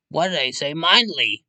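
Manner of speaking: high, loud frustrated outburst; exasperated yell. Real, not acted. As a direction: yell at yourself. Frustrated at own slip.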